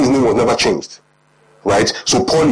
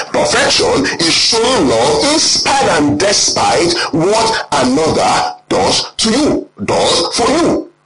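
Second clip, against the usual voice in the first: drawn out, rising for emphasis; slow preacher's repeat; slow, weighty repeat; 3 semitones higher, slower than usual. Shown as a demonstration. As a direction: lean on every word, slowly. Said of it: "Say one more time".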